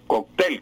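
"cóctel" said kok-TEL; weight on the last syllable of "cóctel"; final stress. Shown as a demonstration. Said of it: "con acento aguda".

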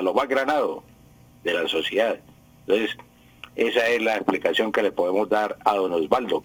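normal talking voice

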